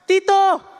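loud, high call of his name from outside. Retold or imitated. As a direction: yell his name to wake him at dawn. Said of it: "Al día siguiente". (grito agudo llamándolo)

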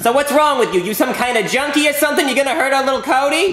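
suspicious mom. Acted. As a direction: grill the stranger.

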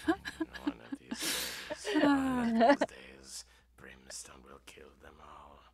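breathy whispered threat; whispered muttering. Acted. Imp muttering threats at the party, whispered. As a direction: mutter murder under your breath.

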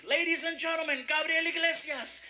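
high, projected announcer call; stage introduction. Retold or imitated. Comedian introduces the next act on stage, announcer voice. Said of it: "he introduces me".

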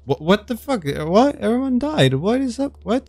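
shocked high-pitched what; startled. Real, not acted. Player shocked when everyone in the game dies, high startled cries. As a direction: yelp of disbelief as everyone vanishes. Jump scare at everyone dying.

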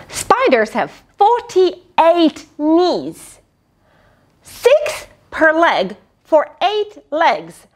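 slow, clear explaining; slow teaching. Shown as a demonstration. Narrator teaches children a spider fact, slow and clear. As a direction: spell it out slowly.

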